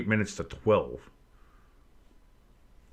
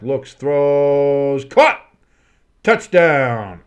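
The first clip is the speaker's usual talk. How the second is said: rising louder and higher to a yelled catch; excited touchdown call; 4 semitones higher, 9 dB louder, much slower than usual. Real, not acted. Calling the winning touchdown for stream viewers, excited yell. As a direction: call the touchdown like the crowd's on its feet.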